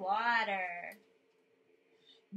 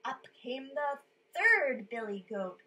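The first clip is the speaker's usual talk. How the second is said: plain reading voice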